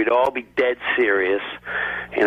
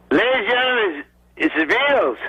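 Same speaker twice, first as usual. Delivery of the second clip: a lightly pitched-up Ed Sullivan introduction; Ed Sullivan impression; 9 semitones higher than usual. Comic doing a weak Ed Sullivan for the crowd, lightly hammed. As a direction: announce the [name] like a mild Ed Sullivan. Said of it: "my newest impression of these ... Ed Sullivan".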